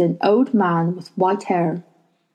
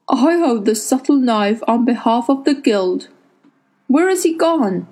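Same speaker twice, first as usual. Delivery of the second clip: put-on old man voice; 4 semitones higher than usual. A narrator's reading.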